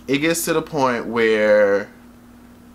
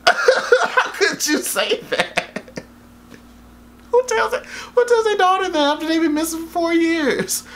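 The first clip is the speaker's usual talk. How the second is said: much higher, incredulous and laughing; laughing disbelief. Real, not acted. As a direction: laugh through your disbelief at the dad. Outraged at the movie dad.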